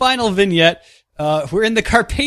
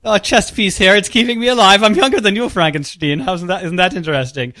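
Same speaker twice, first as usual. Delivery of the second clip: a mock villain's welcome in a mystery accent, pitched high; odd non-Carpathian accent; 5 semitones higher than usual. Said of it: "a strangely not Carpathian accent you have there".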